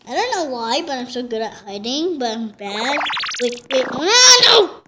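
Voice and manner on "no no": sudden loud no; startled shout. Real, not acted. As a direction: yelp of alarm. Startled in horror game.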